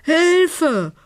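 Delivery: high, piercing tiny fairy cry; a sooty little fairy calls out in distress; cry for help. A narrator's reading. Falling fairy cries for help, high and loud. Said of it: "Hustend und würgend".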